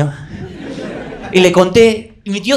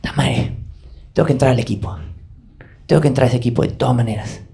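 muttered resolve; 6 semitones lower, 7 dB quieter than usual. Retold or imitated.

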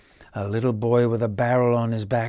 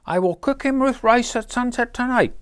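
higher, louder voiced mother; matter-of-fact mother with supper plans; mother planning dinner; 13 semitones higher, 6 dB louder than usual. Boy's mother deciding to cook the duck, voiced higher. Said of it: "said the boy's mother".

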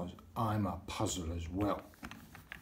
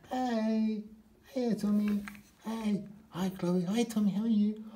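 much higher, chirpy little toy voices; squeaky toy friends; 11 semitones higher than usual. Pretend. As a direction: squeak out greetings like tiny toys. Toy friends greet each other.

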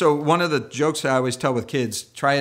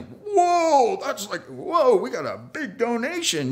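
mock awe; 9 semitones higher, slower than usual. Apostles awed by donation.

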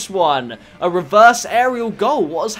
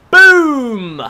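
loud, high goal celebration; exultant yell; triumphant shout; 7 semitones higher, 9 dB louder than usual. Real, not acted. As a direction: boom it out as the shot lands. Scoring a goal.

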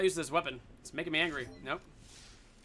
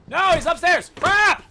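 loud, high yell of dismay; real high-pitched gamer panic; alarmed shout; 8 semitones higher, 10 dB louder, slower than usual. Real, not acted. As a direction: cry out as you're caught out. Caught out by enemy in game.

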